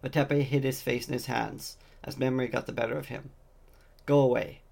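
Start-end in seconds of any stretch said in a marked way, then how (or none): 4.1-4.6 firm, raised voice. Acted. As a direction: tell them to leave, firmly. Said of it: "he repeated".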